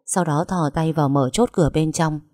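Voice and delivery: usual speaking voice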